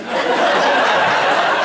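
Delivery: loud breathy laugh; burst of laughter. Real, not acted. Woman laughing about letting the men cut in line, loud laugh. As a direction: laugh out at the memory.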